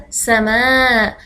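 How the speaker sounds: سماء stretched out slowly and clearly; slow model word for children; slow demo word. Shown as a demonstration. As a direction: say سماء slowly, lingering on each sound. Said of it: "س-، س-،".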